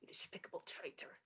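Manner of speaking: very quiet whisper; hushed, venomous aside; whispered contempt. Acted. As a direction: whisper the insult under your breath. Cursing the traitor privately.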